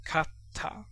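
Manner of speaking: kata said softly as two crisp Japanese syllables, ka-ta. Shown as a demonstration. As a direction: sound out kata the Japanese way, quietly. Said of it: "You read it like this".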